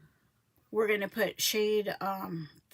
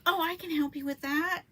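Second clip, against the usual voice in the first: higher-pitched, upbeat quote of someone offering help; eager offer; 8 semitones higher, 4 dB louder than usual. Retold or imitated. Builder offering to help put containers together, eager.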